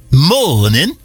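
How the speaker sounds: Morning said low in a British accent. Shown as a demonstration. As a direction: drop the pitch on Morning, British style. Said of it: "No, go lower".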